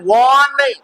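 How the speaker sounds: first वन stretched into a long "wawn"; mispronunciation. Shown as a demonstration. Teacher rejects wrong reading of won, long-vowel वन. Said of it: "W N वन नहीं".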